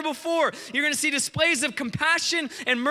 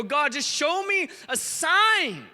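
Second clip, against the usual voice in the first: high, drawn-out plea; pleading prayer; 3 semitones higher than usual. Retold or imitated. Believers looking out to their nations, pleading prayer. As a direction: plead upward, stretching the key words. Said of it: "and go".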